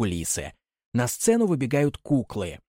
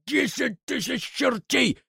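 exasperated oath; 13 semitones higher, slower than usual. Acted. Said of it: "закрыв уши".

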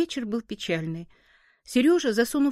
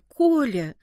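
more urgent, drawn-out plea on the name; pleading; 4 dB louder than usual. A narrator's reading. Boy begs his friend Kolya to stop, pleading insistently. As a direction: call the name out imploringly. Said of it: "с мольбой выговорил я".

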